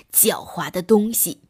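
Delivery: ordinary delivery, nothing marked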